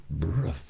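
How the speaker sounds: soft, drawn-out "bruf" noise; imitated splutter. Recounting the girl drooling, imitated splutter. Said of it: "aquela baba caindo assim, ela".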